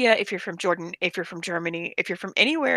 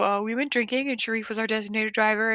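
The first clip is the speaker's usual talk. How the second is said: ordinary delivery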